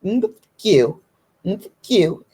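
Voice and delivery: ordinary delivery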